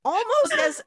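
laughing. Real, not acted. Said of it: "laughing restart".

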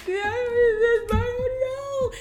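high, drawn-out mock wail; imitated crying. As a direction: wail it out like a blubbering kid. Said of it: "I just broke down".

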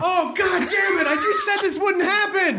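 high, hammed-up exasperated shout; mock outrage. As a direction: yell in fake frustration. Sketch outrage at intruder.

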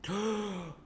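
sharp high gasp for air, breathy; demo gasp. Shown as a demonstration. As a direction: suck in one big gasp of air. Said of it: "what's the first thing you do when you breathe".

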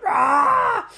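loud, high mock roar; hammed-up villain's bellow; angry roar. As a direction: roar like an enraged monster. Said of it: "He's so angry now".

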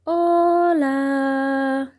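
far-off echoing reply. Acted. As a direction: sound like a distant echo. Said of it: "solo contestó el eco".

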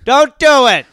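high, full-volume mock yell; over-the-top desperate holler; shouted plea. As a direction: bellow it to stop someone jumping. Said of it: "I just keep saying".

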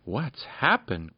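slow, puzzled alarm; startled cry. A narrator's reading. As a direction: Peter staring at the goblin dog, startled. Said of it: "cried Peter, staring".

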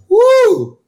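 very high, loud hooted "woo"; imitated relieved "woo". Girl after the policeman leaves, high-pitched imitated whoo. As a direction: let out a high mock whoop. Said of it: "so Lisa goes".

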